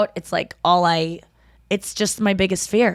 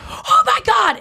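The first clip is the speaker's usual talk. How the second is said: high, gasped cry of terror; imitated last cry; 4 dB louder, slower than usual. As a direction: act out a horrified scream cut short. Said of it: "that was what he heard".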